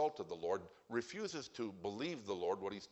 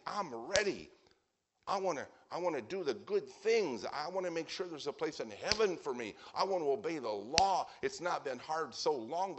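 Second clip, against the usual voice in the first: higher-pitched overeager, confident pleader; earnest, emphatic; 6 semitones higher, 3 dB louder than usual. Acted. Preacher plays self-righteous man begging Jesus for a challenge, emphatic. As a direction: push each wish hard with earnest self-confidence. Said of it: "he is very emphatic".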